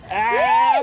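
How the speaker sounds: sudden high strained cry; straining shout. Real, not acted. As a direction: grunt it out under the weight. Straining to lift a heavy fish.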